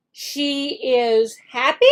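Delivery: slow, questioning read; slow doubtful question. Shown as a demonstration. Teacher pretends to doubt the sentence, slow. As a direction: test the child with a wrong guess. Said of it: "you underline".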